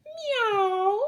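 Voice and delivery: high, loud cat's meow; a big high yowl of a cat. A narrator's reading. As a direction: mew like a startled cat. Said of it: "cried Wilbur".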